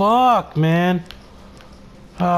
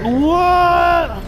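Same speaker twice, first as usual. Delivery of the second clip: much higher, startled shout; shocked shout; 8 semitones higher, 3 dB louder than usual. Real, not acted. Gamer shouting in shock at the screen. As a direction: blurt it out in disbelief. Sudden game surprise.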